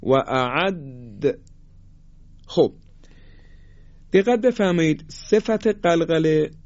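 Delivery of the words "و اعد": و اعد drawn out, د echoing; slow recitation demo. Shown as a demonstration. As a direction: stretch اعد, bounce the د. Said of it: "قلقله بدیم".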